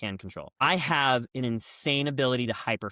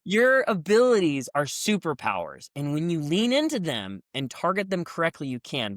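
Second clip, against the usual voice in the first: ordinary talk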